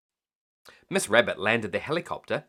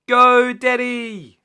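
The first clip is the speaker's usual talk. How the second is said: long high loud cheer; 13 semitones higher, 11 dB louder, much slower than usual. A narrator's reading. Children cheering Daddy's juggling, high loud and drawn out. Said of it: "clapped their hands".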